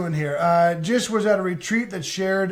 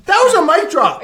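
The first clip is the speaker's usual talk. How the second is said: excited cheer; 10 semitones higher, 7 dB louder than usual. Real, not acted. Cheering a friend's great line.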